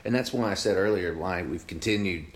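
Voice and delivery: ordinary talk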